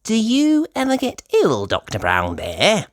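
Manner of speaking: high curious little pig voice; high childlike question; Peppa asking. Acted. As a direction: ask the doctor sweetly. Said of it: "asks Peppa".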